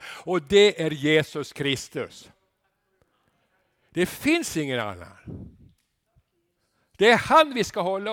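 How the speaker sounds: loud, high, ringing proclamation; full-force preaching. Real, not acted. Pastor proclaiming Jesus as the only king, loud and ringing. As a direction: thunder it from the pulpit.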